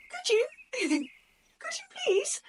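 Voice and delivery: high-pitched, giddy disbelief, breaking into a laugh; excited squeal. Retold or imitated. Told she can interview her hero, squeals with delight. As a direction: squeak it out like you can't believe your luck.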